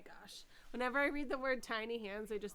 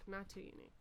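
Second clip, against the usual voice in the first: softer muttered repeat of the words "Not too unique"; quiet; 2 semitones lower, 10 dB quieter, faster than usual. Real, not acted. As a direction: echo "Not too unique" under your breath.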